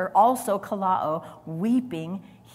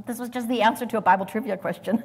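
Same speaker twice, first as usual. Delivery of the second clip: ordinary talk, nothing marked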